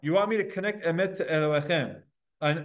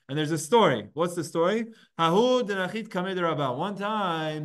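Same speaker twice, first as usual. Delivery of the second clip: ordinary delivery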